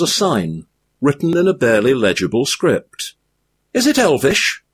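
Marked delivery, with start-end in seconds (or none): 3.7-4.6 high hobbit voice, curious; a small high-pitched hobbit asking; Frodo voice. A narrator's reading. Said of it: "asked Frodo".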